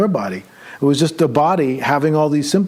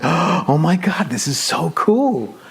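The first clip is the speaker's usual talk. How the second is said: slow awed gasp; 2 semitones higher, slower than usual. Retold or imitated. Amazed in dream.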